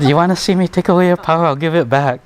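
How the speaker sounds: teasing with a laugh. Retold or imitated. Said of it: "I said".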